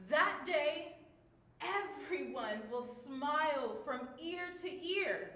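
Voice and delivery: loud, high and beaming; happy, uplifting. Shown as a demonstration. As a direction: share the joy to the back row. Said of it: "using all of our different emotions".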